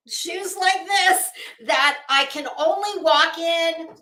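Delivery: ordinary talk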